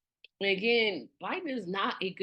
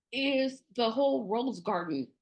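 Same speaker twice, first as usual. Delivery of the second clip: ordinary delivery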